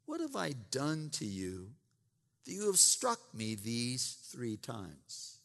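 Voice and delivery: soft, hushed reading of the donkey's words; quiet donkey plea. Acted. Preacher reads the donkey's question to Balaam, softly. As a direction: let the donkey ask it quietly, wounded.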